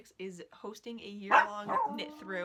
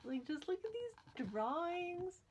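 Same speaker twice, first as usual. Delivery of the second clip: high, slow gushing over the pictures; adoring gush; 10 semitones higher, 3 dB quieter, slower than usual. Real, not acted. Showing viewers the book's drawings, cooing high.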